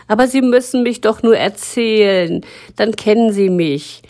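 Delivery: the story pleading with Frau Ringeisen. Acted. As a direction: ask with hope. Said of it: "bat die Geschichte".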